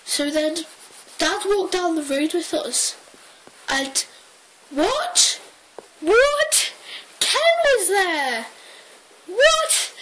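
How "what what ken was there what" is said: very high, stunned what; astonished. Real, not acted. Teller stunned that Ken appeared, very high and incredulous. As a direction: gasp it out in disbelief.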